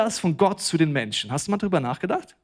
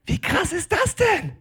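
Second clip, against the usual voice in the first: very high, stretched-out squeal of delight; mock amazement. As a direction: squeal it in hammed-up awe. Acts a giver expecting hundredfold return.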